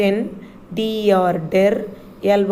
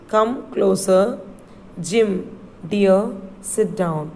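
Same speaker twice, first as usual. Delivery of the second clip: "Come closer" drawn out slowly; slow model reading; slower than usual. Shown as a demonstration. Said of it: "let's read the next line".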